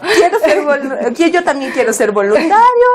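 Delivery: stumbling, laughing parody of the eager classmate; laughing mock volunteer. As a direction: play the tagalong friend while giggling. Parodia al compañero. (imitando al compañero entre risas)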